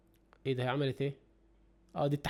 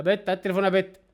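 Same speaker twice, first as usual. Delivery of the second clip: thief demands phone; 4 semitones higher, 5 dB louder than usual. Retold or imitated. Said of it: "قال لها".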